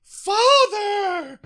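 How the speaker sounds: high, far-off cry of Father; Talanji crying out. Retold or imitated. Talanji calling down to her dying father, high cry. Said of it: "Talanji go".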